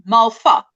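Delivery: Malfa with the v softened to an f; soft V. Shown as a demonstration. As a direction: say Malfa, soft f sound in the middle. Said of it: "we say a soft V".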